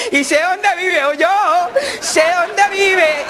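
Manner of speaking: aguda, lenta, entre risas; niño pícaro riendo. Acted. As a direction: chantajea riéndose con voz de niño. Niño chantajea con risa. (voz aguda de niño que se ríe)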